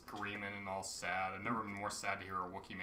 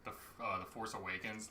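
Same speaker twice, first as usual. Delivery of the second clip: usual speaking voice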